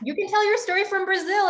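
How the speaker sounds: high, lightly mocking self-quote; retold own quote. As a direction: replay her own blunder lightly. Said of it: "I was like".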